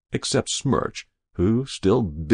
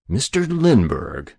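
slow, slightly raised indignation; senator's contained rage; 4 semitones higher, 4 dB louder than usual. A narrator's reading. Senator rebuking the young hero, enraged. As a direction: begin indignantly, drawn out and pitched up. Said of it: "began a United States senator purple with rage".

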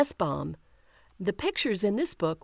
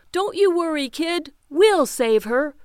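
reassuring big brother; 6 semitones higher, 7 dB louder than usual. Acted. Big brother reassures little sister.

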